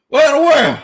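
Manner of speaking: high, loud what in the world; disbelief. Real, not acted. Streamer reacting to a chat prediction, loud and shocked. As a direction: blurt it out in shock.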